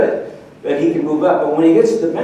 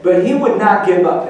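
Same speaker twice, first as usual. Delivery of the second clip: usual speaking voice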